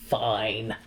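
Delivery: low, soft grudging give-in; grudging fine. Retold or imitated. Wiz giving in to Dorothy, low and soft. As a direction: concede quietly and sulkily. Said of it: "then he's like".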